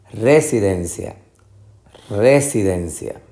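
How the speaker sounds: residencia with RE punched; misplaced first stress. Shown as a demonstration. Teacher testing options, RE-sidencia stressed first. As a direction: hit RE at the start of residencia. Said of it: "tratar de identificar".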